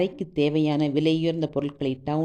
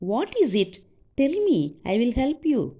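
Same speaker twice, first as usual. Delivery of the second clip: high-pitched, eager offer of help; concerned stranger; 8 semitones higher, much slower than usual. A narrator's reading. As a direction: voice a woman urging a crying girl to speak. (உதவ முன்வரும் பெண்ணின் உயர்ந்த குரல்)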